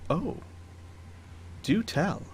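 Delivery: softly intrigued noblewoman, lightly voiced; a quiet, drawn-out invitation to go on; Lady Trask. Baroness invites the pathfinders to explain, soft intrigue. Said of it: "Lady Trask looks to you guys and says".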